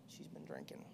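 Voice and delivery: whispered "she's been drinking" under the breath. Retold or imitated. Chrissy whispering to herself about her drunk friend. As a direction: whisper it so nobody hears. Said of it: "she said".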